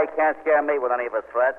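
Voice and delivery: normal talking voice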